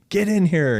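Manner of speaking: high, urgent summons; yelling for wife. Retold or imitated. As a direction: call her in from another room. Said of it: "yelling my wife's name".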